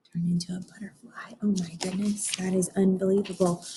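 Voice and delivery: hushed amazed whisper; awed whisper. Real, not acted. Teacher marveling with the children, whispering in awe. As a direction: breathe it in wonder.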